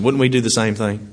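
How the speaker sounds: usual speaking voice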